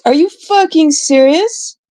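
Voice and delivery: high, slow, disbelieving outrage; real outrage at the offer, pitched high and dragged out; incredulous anger. Real, not acted. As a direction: stretch it out in angry disbelief.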